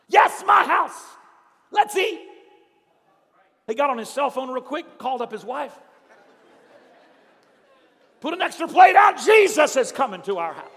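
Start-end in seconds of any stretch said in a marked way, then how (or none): 0.1-2.2 loud, high and slow joyful shout; overjoyed host shouting his welcome. Acted. Zacchaeus overjoyed. 8.2-10.7 loud, high excited shout down the phone; excited phone call. Acted. Calls wife on phone.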